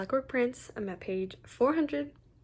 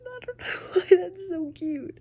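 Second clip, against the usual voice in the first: louder, high, crying through the words; teary; 8 semitones higher, 6 dB louder than usual. Real, not acted. Reader sobbing over the couple's scene. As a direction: push it out half-sobbing. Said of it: "I'm really out here bawling".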